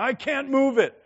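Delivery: loud, high, crying complaint; a tearful boy giving up on the log. Retold or imitated. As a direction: wail it like a kid in tears. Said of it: "in a heap of tears going".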